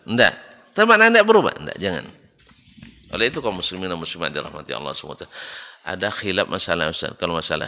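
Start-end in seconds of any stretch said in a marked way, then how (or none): 0.8-1.5 high, loud acted comeback question; mock objector. Imagined pupil insists the meaning is unchanged, mock protest. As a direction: push back as an insistent pupil, lightly acted. Voices objector after teacher says no.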